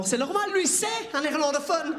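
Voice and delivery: high, mock Flemish-accented policeman. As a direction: answer in French with a put-on Flemish accent. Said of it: "en français avec un accent flamand".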